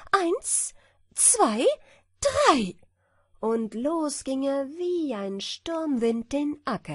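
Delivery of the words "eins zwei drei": high, rising count; hare counting. A narrator's reading. The hare counts down the start, high and loud. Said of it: "Der Hase zählte".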